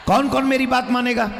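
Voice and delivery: high shouted question to the crowd; calling out. Real, not acted. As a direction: call out to the whole crowd.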